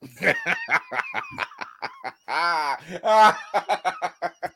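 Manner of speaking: evil cackle, high and drawn out; a long wicked villain's laugh. Real, not acted. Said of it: "I'm sorry, the villain laugh".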